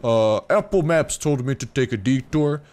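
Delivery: a lightly put-on goofy dino voice; voicing Mike the trike. Gamer voicing his stray trike Mike, lightly goofy. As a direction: play the clueless trike who wandered off. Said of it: "and Mike's like".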